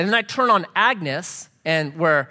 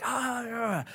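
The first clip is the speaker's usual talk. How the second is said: soft, higher-pitched wordless moan; imitated distressed moaning; 5 semitones higher, 9 dB quieter than usual. Pastor acting the distraught woman sitting down, imitated moans. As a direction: imitate someone moaning in torment. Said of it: "she's just like".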